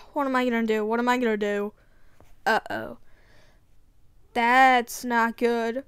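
low, soft and hurried to oneself; muttered worry. Acted. Alone as the house keeps moving, muttering anxiously. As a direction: fret under your breath, quick and low.

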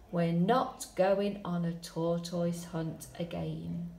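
slow build, whispered tiptoe, high panicked escape; dramatic story reading. Shown as a demonstration. Teacher reading the longer ending, hushed then frantic. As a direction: read it like a scary bedtime adventure.

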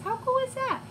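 plain reading voice

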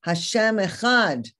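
"Hashem Echad" lifted and stronger; mild retold outcry; powerful cry. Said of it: "in a powerful voice emerging from the depths".

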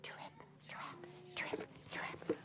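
tiny whispered trip trap; little goat crossing the bridge, whispered; whispered hoofsteps. Shown as a demonstration. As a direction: tiptoe it in a whisper. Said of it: "her little hooves went what?".

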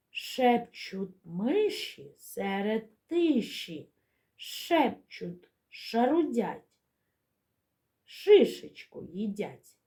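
slow, deliberate verse for little ones; measured nursery-rhyme reading; children's rhyme recital. Shown as a demonstration. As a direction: recite slowly, lingering on each hushing sound. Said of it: "Послухайте віршик".

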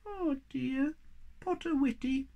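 high squeaky little creature, kindly; sympathetic squeak. Acted. Thingamy comforting sad Moomin Troll, high and sympathetic. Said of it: "said Thingamy sympathetically".

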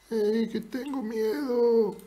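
high, soft, drawn-out silly voice; put-on voice. Shown as a demonstration. Streamer showing a rival another voice for admitting fear, put-on voice. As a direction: say it slowly in a small high voice. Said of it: "pero con otra puedes decirlo".

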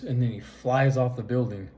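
ordinary delivery

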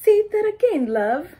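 high, loud mock British accent on say that again; chirpy local Brit asking you to repeat; imitating a British local. Local asks the newcomer to repeat, mock British accent. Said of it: "then the local tells you".

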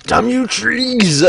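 slow, high, hammed-up curse; mock dramatic curse. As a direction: shake a fist at the trees. Mock curse after crash.

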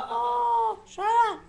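high, loud playful shout. Real, not acted. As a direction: yell it in jest. Retorting playfully.

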